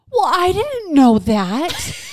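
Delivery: high, drawn-out imitated kid whine; protesting child. Child told demon is a mean word, imitated high protest. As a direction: protest innocence in an exaggerated child's voice.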